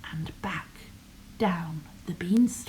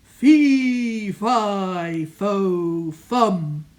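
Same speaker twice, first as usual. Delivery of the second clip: booming giant roar, loud and slow at the end; giant shouting; 7 dB louder, slower than usual. Acted. Giant roaring at the hidden boy, booming and loud. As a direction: bellow it like a huge hungry giant. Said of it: "the giant shouting out".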